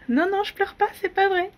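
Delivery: high, tearful protest; teary denial. Real, not acted. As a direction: insist you're fine while crying. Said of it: "Si, complètement".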